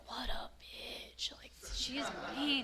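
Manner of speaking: whispered under the breath. Retold or imitated. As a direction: hiss the insult so the judge can't hear. Muttering insult about the judge.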